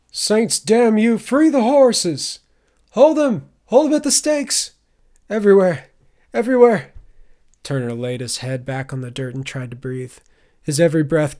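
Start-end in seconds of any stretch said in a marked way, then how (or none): 0.1-6.9 frantic shouts. A narrator's reading. Men in the camp shout orders under attack, frantic yelling. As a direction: yell orders across a camp in panic. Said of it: "Other shouts came from the camp".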